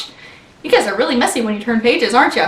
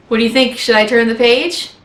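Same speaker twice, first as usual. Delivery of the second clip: usual speaking voice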